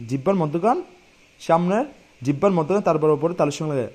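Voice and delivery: ordinary talk, nothing marked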